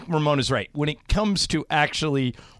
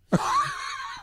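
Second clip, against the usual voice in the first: high burst of laughter; 6 dB quieter than usual. Real, not acted. High laugh at Ramona's quick no. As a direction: let a big high laugh escape.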